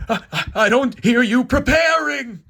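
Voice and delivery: stammered, high, loud reprimand; scolding God. Acted. God at angels just standing there, scolding shout. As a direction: holler it at the idle troops.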